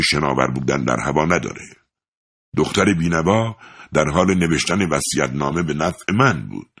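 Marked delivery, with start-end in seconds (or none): none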